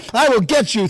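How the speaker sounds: half-acted street menace; aggressive threat. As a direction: promise payback like a street tough. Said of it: "I was always like".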